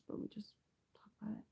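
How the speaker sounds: whispered aside; hushed whispered aside. Real, not acted. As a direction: drop to a secretive whisper.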